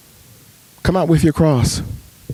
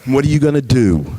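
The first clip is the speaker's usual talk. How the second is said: God's reply; slower than usual. Retold or imitated. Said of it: "I see God saying".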